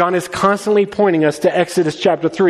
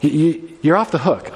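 usual speaking voice

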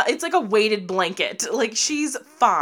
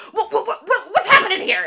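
high, stammering mock panic; imitating a flustered father; upset dad; 12 semitones higher, 6 dB louder, faster than usual. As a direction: splutter it as an alarmed dad, building louder. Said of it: "like, gets so upset".

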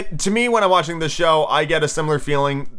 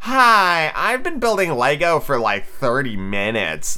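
clueless amateur; 4 semitones higher, slower than usual. Acted. Host mimics a weaker contestant, mock clueless. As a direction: introduce yourself high and naively proud.

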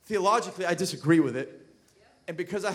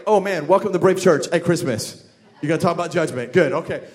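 louder, lower, sarcastic groan of a reluctant listener; dry mock dread, louder than the talk; mock dismay; 4 semitones lower, 9 dB louder than usual. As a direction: sound like you just got trapped in a judgment sermon. Voices a listener dreading the topic.

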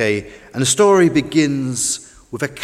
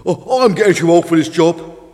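put-on louder, weary old fishing boat voice; puffed old boat; 3 semitones higher, 7 dB louder than usual. A narrator's reading. Old Fish complains to Tug about his job, puffed. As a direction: grumble it with effort. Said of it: "puffed Fish".

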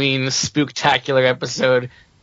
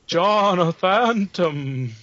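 spooky voice; 4 semitones higher, much slower than usual. Pretend. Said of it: "spook up my name".

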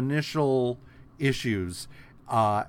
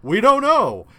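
much higher, louder careless answer; mock shrug shout; 10 semitones higher, 11 dB louder than usual. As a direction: blurt it out like you couldn't care less. Eternals answer Thor.

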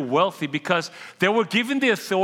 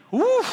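excited whoop; 4 dB louder than usual. Acted. Reacting to five million dollars.

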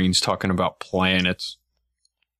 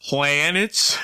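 imitating Don Pettit; 5 semitones higher than usual. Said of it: "Don Petit going".

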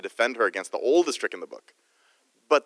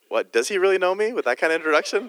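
higher-pitched, lightly incredulous quote; taken aback, retold; mock surprise; 7 semitones higher, 3 dB louder than usual. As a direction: replay your own startled reaction. Acting out his own surprised reaction.

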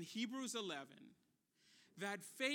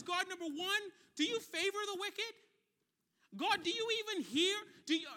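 raised, forceful questioning of God; frustrated yelling; 5 semitones higher, 5 dB louder than usual. Retold or imitated. Job demands whether God sees or hears him, frustrated yelling. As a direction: act out an exasperated, high-pitched challenge. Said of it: "And he's yelling at God".

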